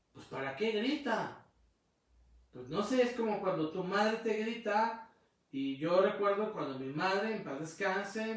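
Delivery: usual speaking voice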